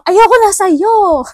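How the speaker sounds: loud, high, slow and cutting; harsh breakup line. Pretend. Snapping at a partner the wrong way, loud and harsh. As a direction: spit it out like you're done with them. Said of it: "In a nice way".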